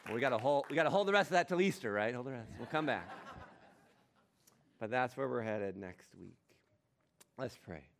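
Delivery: ordinary talk, nothing marked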